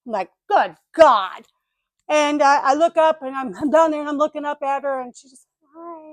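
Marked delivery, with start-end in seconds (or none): 0.5-1.5 loud, fed-up groaned exclamation; mock overwhelmed exasperation; exasperated outburst. Tangled, huffing, exasperated outburst. 5.8-6.1 very soft, high little greeting; hushed mock-prim hello; prim lady. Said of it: "all prim and proper".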